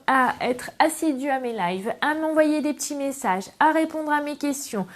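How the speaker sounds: usual speaking voice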